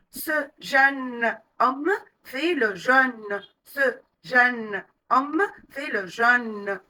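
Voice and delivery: slow practice sentence, each vowel exaggerated; over-articulate "jeune" open and "jeûne" closed; slow exaggerated drill. Shown as a demonstration. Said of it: "répéter ces phrases à voix haute en exagérant".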